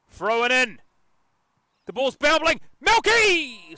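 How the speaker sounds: high, loud play-by-play burst; excited match call. Real, not acted. Commentator calls a bobbling ball, excited shout. Live call as chance develops.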